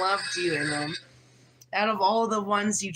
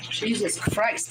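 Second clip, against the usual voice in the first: usual speaking voice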